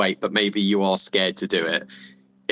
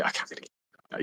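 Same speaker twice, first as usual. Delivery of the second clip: whispered under the breath; a quick private whisper while reaching for the phone; whispered aside; 11 dB quieter than usual. Retold or imitated. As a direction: whisper it to yourself. Muttering to self, phone out.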